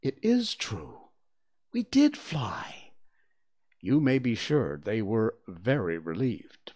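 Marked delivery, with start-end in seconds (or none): 0.0-2.9 slow, whispery wonder, rising high; drawn-out, breathy amazement; sleepy mutter. Acted. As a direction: murmur it slowly, half awake. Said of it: "John would rub his eyes and mutter".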